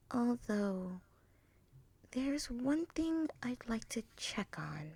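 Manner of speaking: a hint of a young mare musing softly; lightly voiced, half to herself; mild Twilight voice. Twilight reassuring herself while patrolling, musing quietly.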